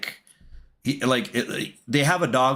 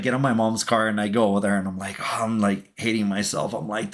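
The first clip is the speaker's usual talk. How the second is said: ordinary delivery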